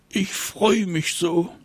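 high bright-eyed mother dragon gushing; a high, happy dragon mother; delighted mama dragon. Acted. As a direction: gush with joy, pitched well up.

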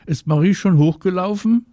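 high, loud question; calling out. A narrator's reading. Marie's mama asks Anna's mama from the balcony, calling out. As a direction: call down to another parent. Mother calls down to neighbour.

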